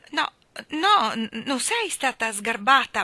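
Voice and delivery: high, stammered retreat; flustered backtracking. A narrator's reading. Jane hastily takes it back before Mary Poppins, flustered. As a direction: stumble over the words as you back down. Child backs down before angry nanny.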